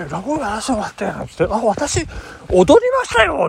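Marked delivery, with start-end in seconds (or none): 1.6-3.5 higher, brighter imitation of the waitress; young waitress voice. Waitress tells the storyteller she danced at his event, chirpy and higher. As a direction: play the young waitress chiming in.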